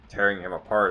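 ordinary talk, nothing marked

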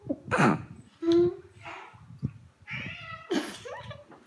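high-pitched burst of laughter. Real, not acted. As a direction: crack up laughing. Laughing at bad jelly bean.

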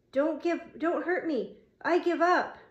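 high-pitched, pleading surrender; convincing frightened peep. A narrator's reading. Roy surrendering to the bully pinning him, high frightened peep. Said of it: "Roy peeped convincingly".

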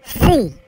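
loud pupil repeat of シー; repeating after a teacher. Shown as a demonstration. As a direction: echo the teacher's シー. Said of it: "リピート。".

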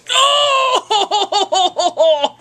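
high laughing yelp of pain. Real, not acted. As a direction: yelp as the tape rips off. Ripping tape off his face.

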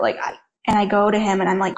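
ordinary talk

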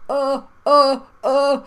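loud, high, dumbstruck "uh"; speechless vowel. Acted. Vowel answers the alien, loud stunned "uh". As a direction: punch out stunned, repeated "uh" sounds. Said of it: "all the vowel can do is go".

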